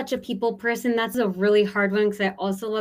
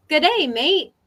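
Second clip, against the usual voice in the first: higher, louder, hammed-up Australian greeting; attempted Australian accent; 7 semitones higher, 7 dB louder, slower than usual. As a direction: try a badly faked Australian accent. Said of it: "in an Australian accent".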